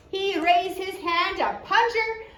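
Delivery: usual speaking voice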